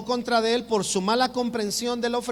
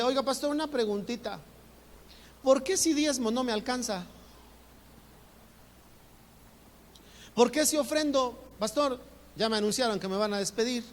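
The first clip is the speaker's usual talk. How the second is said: higher, pleading questions to the pastor; doubting churchgoer; 4 semitones higher than usual. Retold or imitated. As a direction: lightly voice a worried member asking why tithing fails. Congregant questioning tithing to pastor.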